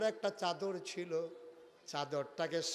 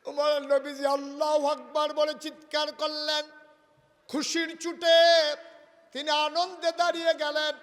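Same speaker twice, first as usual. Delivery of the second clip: raised, exultant sermon narration; jubilant preaching; 12 semitones higher, 15 dB louder than usual. Acted. Preacher telling of the Prophet's joy, jubilant preaching. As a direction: punch out each phrase with fervor.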